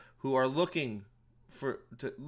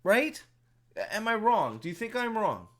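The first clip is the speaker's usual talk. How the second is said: raised, loud and higher ranting voice; 8 semitones higher, 6 dB louder than usual. Real, not acted. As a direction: vent at full volume at the audience. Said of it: "I'm sorry that was so loud".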